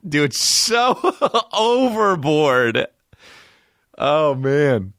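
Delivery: high, slow words through laughter; laughing. Real, not acted. Laughing at the tweet.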